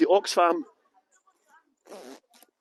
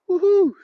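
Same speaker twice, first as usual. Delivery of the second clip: short, high woohoo; cheery whoop; 11 semitones higher, 5 dB louder than usual. Real, not acted. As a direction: whoop high to greet an arrival. Cheery whoop greeting arrivals.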